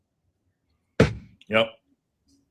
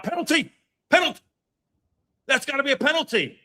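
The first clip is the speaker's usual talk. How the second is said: shouting. Real, not acted. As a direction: scream for the penalty. Appealing for a penalty.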